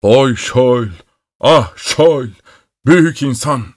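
put-on keen over the dead boy, close to bursting; forced, monotone lament on the edge of breaking; strained lament. A narrator's reading. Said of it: "Tek düze, zorlamalı, patlamaya hazır bir sesle".

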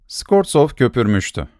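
plain reading voice

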